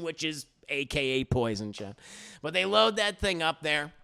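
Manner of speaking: ordinary delivery, nothing marked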